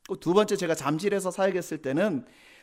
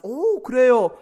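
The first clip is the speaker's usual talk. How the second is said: pitched-up, louder "oh, really?" of glad recognition; surprised delight; 6 semitones higher, 6 dB louder, much slower than usual. Retold or imitated. Pastor mimics a churchgoer greeting a fellow alumnus, pleased surprise. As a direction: sound thrilled to meet a fellow alumnus.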